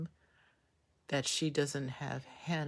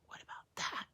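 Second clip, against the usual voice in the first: What about that? breathed unvoiced, much softer; a secretive whisper to the viewers; conspiratorial whisper; 11 dB quieter than usual. Real, not acted. Repeated conspiratorial question.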